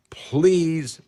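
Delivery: ordinary talk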